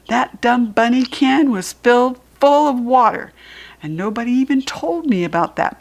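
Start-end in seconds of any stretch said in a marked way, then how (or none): none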